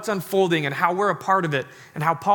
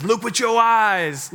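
slow, drawn-out, higher and louder silly goat voice; cross-eyed goat; 5 semitones higher, 5 dB louder, slower than usual. Acted. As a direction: stretch every word like a dopey goat. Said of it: "cross-eyed goat looks at them and says".

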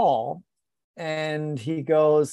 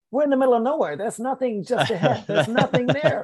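exasperated protest; 8 semitones higher than usual. Retold or imitated. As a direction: throw the claim back in disbelief. Arguing distance with pacer.

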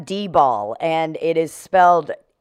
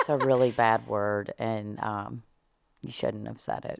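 much lower, much softer gentle reproach; soft teacher scolding; 9 semitones lower, 12 dB quieter than usual. Retold or imitated. Nun gently telling the kindergartner off, low and hushed. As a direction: hush it like a kind nun.

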